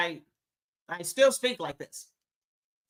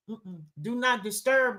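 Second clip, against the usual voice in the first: normal talking voice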